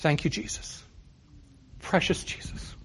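soft whispered prayer, barely voiced; under her breath. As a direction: breathe it as a private murmured thanks. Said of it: "I would hear under her breath".

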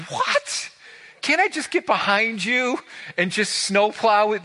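high, incredulous begging; disbelieving plea. Retold or imitated. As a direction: beg not to have to walk down. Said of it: "I'm like".

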